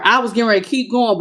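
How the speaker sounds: normal talking voice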